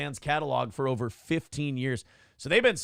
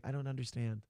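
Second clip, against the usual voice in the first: small, much softer put-on voice; 3 semitones lower, 10 dB quieter than usual. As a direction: play it quietly bewildered, like a child. [name]'s meek reply in acted quarrel.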